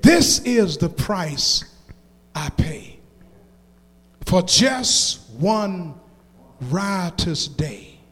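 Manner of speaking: slow, soft, low verse; solemn poem recitation. Acted. Preacher quoting poet on the price of sin, solemn recitation. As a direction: recite it slowly, pausing after each phrase.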